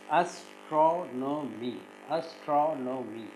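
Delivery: slow "astronomy" in four separate syllables; "astronomy" with each syllable set apart. Shown as a demonstration. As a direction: break "astronomy" into as, tro, no, my. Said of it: "how to syllabify this".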